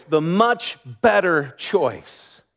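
ordinary delivery

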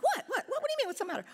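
high, startled repeated what; confused surprise. Retold or imitated. Wife answering husband's question, mock startled and confused. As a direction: act caught off guard, pitch jumping up. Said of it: "I'm like".

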